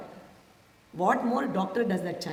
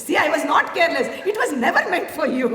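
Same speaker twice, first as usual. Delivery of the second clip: laughing; 5 semitones higher, 6 dB louder, faster than usual. Retold or imitated. Teasing husband, laughing in triumph.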